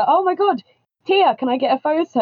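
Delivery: high, eager gush; lightly voiced fan asking for a photo; excited fan. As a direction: ask like a starstruck fan. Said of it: "people would be like".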